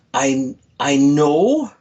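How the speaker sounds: very slow start in a lightly put-on survivor's accent; a hint of her accent, drawn out; Alice Somers' accent. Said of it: "I can't do her accent justice".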